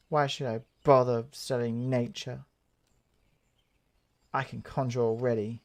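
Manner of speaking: low, quiet grumble of a bored student; sullen pupil. Acted. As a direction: mutter it like a reluctant pupil. Voices Sylphie, first low then higher.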